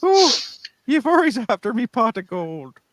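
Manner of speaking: high lilting leprechaun voice. Pretend. Irish guest jokes about pot of gold.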